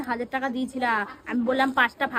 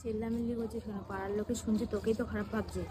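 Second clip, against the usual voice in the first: low, much softer warning; hushed reproach; 6 semitones lower, 12 dB quieter than usual. Acted. Warning the shouting man that neighbours are listening, hushed. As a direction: hush him with a lowered voice. Shushing him so neighbours won't hear.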